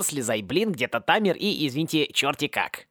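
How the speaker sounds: usual speaking voice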